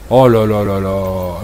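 slow, low groaning oh là là; dismayed sigh. Real, not acted. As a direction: drag it out in despair. Dismayed at the bad writing.

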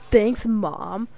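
ordinary talk

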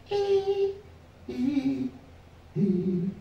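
high beep sinking and fading; fading test beeps. Retold or imitated. Comic imitating hearing test tones that fade away, high beeps. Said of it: "noises of descending volume".

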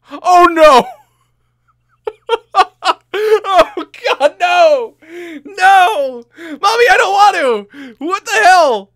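real high, very loud panicked wailing; scared. Real, not acted. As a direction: scream in fright with nervous laughs breaking in. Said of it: "I get scared at the simplest things".

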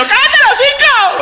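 very high, slow, loud shout; dad yelling at his daughter; furious yell. Retold or imitated. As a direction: blow up at the child. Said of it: "Y yo".

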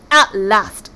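loud, snapped outburst; impatient snap. A narrator's reading. Sea god opening his door to late visitors, snapping. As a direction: snap it out forcefully. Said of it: "snapped Ager".

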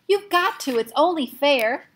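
high, loud insistent plea; pleading demand. Acted. Patrick demanding Omri send him back, loud. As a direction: beg hard to be sent.

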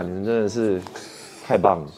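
usual speaking voice

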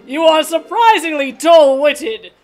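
haughty boat. Pretend. Boat king scolding Link, lightly voiced. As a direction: scold him like a wise old boat.

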